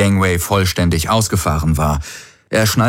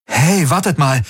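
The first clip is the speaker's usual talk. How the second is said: sudden higher-pitched call; 10 semitones higher than usual. A narrator's reading. Garland alerts his companions in the shuttle, calling out. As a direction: call out to stop the others. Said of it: "rief er aus".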